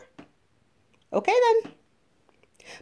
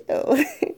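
high giggle. Acted. Giggle after flirting.

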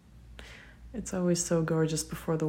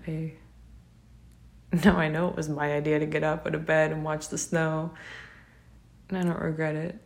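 usual speaking voice

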